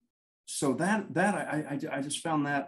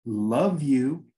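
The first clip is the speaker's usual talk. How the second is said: repeated a bit louder and fuller; louder retold reply; 6 dB louder than usual. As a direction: say it again, pushing it out louder. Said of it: "it came louder right after that".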